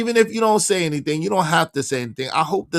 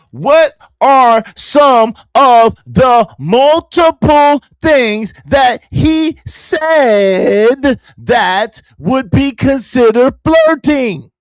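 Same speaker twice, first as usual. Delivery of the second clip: slow, loud, higher, drawn-out mock question; 5 semitones higher, 10 dB louder, much slower than usual. Mocking the guest's question, slow and loud. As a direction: stretch each word out loud, mocking her.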